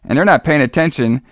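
usual speaking voice

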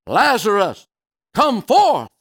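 high, loud call to Lazarus; a ringing high summons. A narrator's reading. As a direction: call him out of the tomb. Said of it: "spoke those words".

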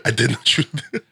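mock Jamaican accent through laughter; jokey Jamaican accent, trailing off. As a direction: repeat it in a comic Jamaican accent. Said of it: "That's my [name] impression".

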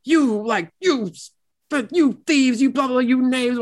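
high, sputtering outraged bluster; in a huff. Retold or imitated. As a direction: splutter insults up high in a huff. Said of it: "he's all in his huff".

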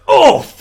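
high, loud acted yelp; sudden mock howl of pain; cry of pain. Imitating her as she cuts her arm, cry of pain. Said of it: "cuts her arm".

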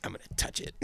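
whispered, conspiratorial nature host; a mock-whispered "I'm gonna touch it". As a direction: hush it like sneaking up on an animal. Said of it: "He's like".

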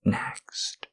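breathy close-mic ASMR whisper; intimate whisper. Acted. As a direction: whisper right into the ear, unhurried.

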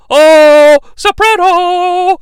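very high, drawn-out soprano; falsetto joke. Retold or imitated. Mocking Shane's groin joke in a long falsetto. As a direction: squeak it in a sustained falsetto. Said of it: "says Shane".

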